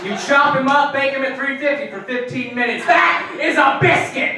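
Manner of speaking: loud, high rant building to a shout; outraged comic climax; yelling tirade. Retold or imitated. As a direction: build to a yell on the last words. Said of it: "yelling about something".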